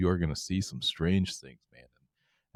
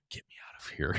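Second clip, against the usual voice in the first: breathy whisper, barely voiced; wife whispering to husband across the aisle, desperate; whispered plea; 3 semitones lower, 11 dB quieter, faster than usual.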